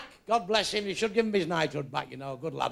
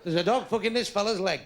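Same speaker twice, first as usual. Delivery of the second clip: ordinary talk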